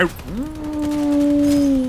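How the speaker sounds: long high drawn-out ooh; surprised ooh. Real, not acted. Surprised at enemy build.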